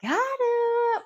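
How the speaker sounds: high squealing gotcha; gossiping servant. Retold or imitated. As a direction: squeal like a nosy servant catching them. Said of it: "a servant or somebody to be like".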